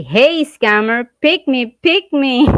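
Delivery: high, loud, eager calling out; hammed-up plea to be picked; mock eager woman. Vlogger mocking women begging a scammer, loud eager calls.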